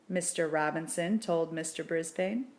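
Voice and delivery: usual speaking voice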